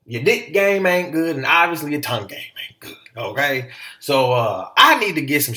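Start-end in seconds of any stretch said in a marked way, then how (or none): 0.1-3.0 mock scolding wife. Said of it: "Obviously she said".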